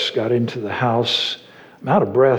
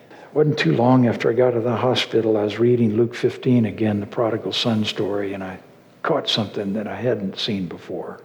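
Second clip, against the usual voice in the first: ordinary talk, nothing marked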